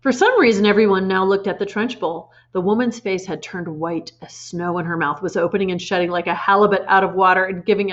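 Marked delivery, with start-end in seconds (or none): none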